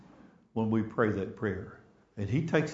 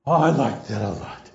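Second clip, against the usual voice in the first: emphatic; 2 semitones lower, 6 dB louder than usual. Real, not acted. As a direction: repeat it aloud with real relish. Savoring his own story.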